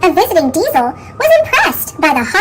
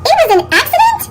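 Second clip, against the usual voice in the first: much higher, astonished question; surprised peep; 12 semitones higher, much faster than usual. A narrator's reading. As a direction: sound amazed at the news. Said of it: "peeped Thomas".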